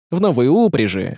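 plain reading voice

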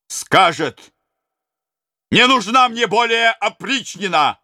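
high, loud rousing speech; rousing prince. Acted. Prince to the robbers, high loud rousing speech. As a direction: stir the men up with hope.

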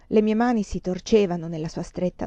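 plain reading voice

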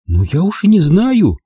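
high, lightly voiced girl; Angela admitting. A narrator's reading. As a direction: admit it with a shrug. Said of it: "призналась она". (Анжела неуверенно признаётся, высоким голосом)

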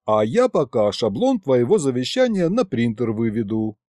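usual speaking voice

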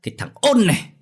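father flaring up at his son; enraged father. A narrator's reading. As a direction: scold the child in a rising fury.

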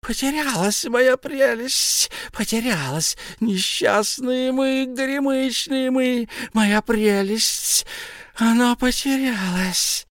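very high, slow, lamenting creature; keen over the lost treasure; wailing Gollum. A narrator's reading. Gollum laments his lost precious, high and slow.

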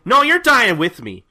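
high, loud hammed-up vow; defiant shout. Kagura refusing to let go of Gin, defiant mock shout. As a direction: yell it like a spiteful last stand. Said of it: "Kagura spits on Gin's foot and is like".

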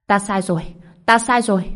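pained wailing plea, rising; burning scream. A narrator's reading. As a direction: scream the apology in agony. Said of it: "Hỏa Thánh gào thét".

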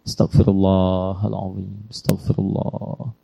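slow, soft, drawn-out recitation of astaghfirullah; slow istighfar. Shown as a demonstration. Preacher showing the congregation the short istighfar, slow and soft. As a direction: let each syllable of "Astaghfirullahaladzim" stretch out softly. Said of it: "Kalimat singkatnya begini".

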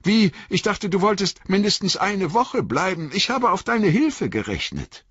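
plead, let down that the wizard is leaving; dismayed young Frodo. A narrator's reading. Frodo voiced by reader.

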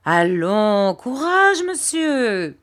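loud, high, hearty landlady encouragement; rally him like a motherly old landlady; landlady cheering him on. A narrator's reading. Landlady urging her gloomy lodger to take heart, loud and high.

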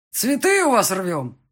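loud, slow, cheeky answer; guest calling back. A narrator's reading. Wedding guest answering the clerk, loudly unabashed. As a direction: holler it back, drawn out. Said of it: "отозвался один из гостей".

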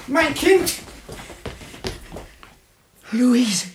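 horrified outcry; anguished cry. Acted. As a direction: cry out at the sight of your dead child. Father finds dead daughter.

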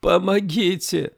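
high, drawn-out cry for help; boy's cry. A narrator's reading. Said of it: "Его крик буквально наложился на её".

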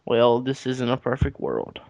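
ordinary talk, nothing marked

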